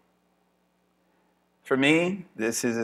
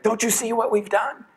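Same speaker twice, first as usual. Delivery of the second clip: much higher, hammed-up proud father; German father character; 12 semitones higher, 4 dB louder than usual. Comic German dad showing baby a battleship, mock pride. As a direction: show off the battleship in a silly high voice. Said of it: "he's a German and he's like".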